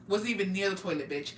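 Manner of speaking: ordinary talk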